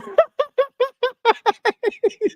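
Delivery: long high giggle; laugh. Real, not acted. Streamer told everybody lies to him, high laughter. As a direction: dissolve into high-pitched laughter.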